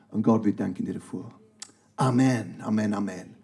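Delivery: Gebet, ernst und ruhig; prayer. Acted. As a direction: pray it sincerely to God. Closing prayer.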